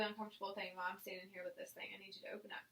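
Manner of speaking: fast hushed whisper. Real, not acted. Whispering over the uncomfortable step.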